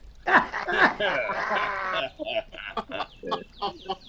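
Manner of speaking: mocking chuckle. Gang leader mocking the Yankee soldier, taunting chuckle. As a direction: laugh at him like he's prey.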